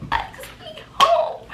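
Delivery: very high-pitched, drawn-out plea; squealed plea. Retold or imitated. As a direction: squeal it like a desperate demand. Pleading to be driven home.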